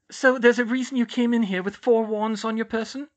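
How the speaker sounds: high, loud, questioning matron; Madame Pomfrey's character voice. Acted. Nurse questioning Hermione about four wands, high character voice. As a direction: interrogate in a high-pitched nurse's voice.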